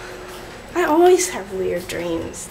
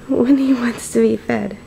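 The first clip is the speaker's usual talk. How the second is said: ordinary delivery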